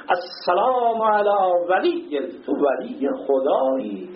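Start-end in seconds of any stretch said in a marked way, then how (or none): 0.1-2.2 slow drawn greeting. Acted.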